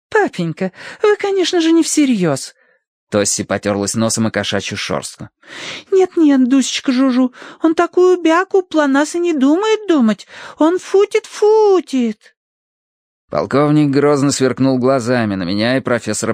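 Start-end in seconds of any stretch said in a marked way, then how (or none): 0.1-2.5 put-on high, girlish reproach; protesting daughter. Daughter protests papa's unkindness to cat, girlish reproach. 5.9-12.3 very high cooing to a pet; girlish baby talk. Acted. Daughter comforts her cat, cooing baby talk.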